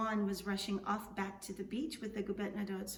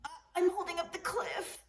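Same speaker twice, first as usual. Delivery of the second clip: high, strained voice, as if holding up a cliff; struggling under a heavy load, voice raised high; shaky strain; 9 semitones higher, 3 dB louder than usual. Acted. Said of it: "Juan's voice was shaky".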